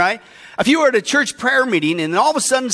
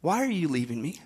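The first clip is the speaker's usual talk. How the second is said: put-on fading, hushed child's plea; a small voice fading out; 8 semitones lower, 8 dB quieter than usual. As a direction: let the child's question die away to almost nothing. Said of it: "your son is there going".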